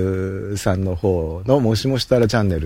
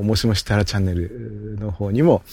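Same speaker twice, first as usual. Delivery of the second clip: ordinary talk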